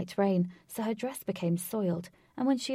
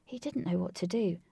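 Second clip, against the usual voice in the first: ordinary delivery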